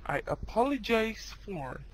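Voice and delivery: slow, careful teaching read; slow model phrase. Shown as a demonstration. Teacher reading it slowly to students. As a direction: spell it out slowly for the class.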